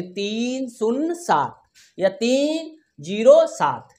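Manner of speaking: तीन, शून्य, सात slow and separate; digit by digit. Shown as a demonstration. Teacher showing the right decimal reading, तीन शून्य सात digit by digit. अंकवार पढ़ेंगे.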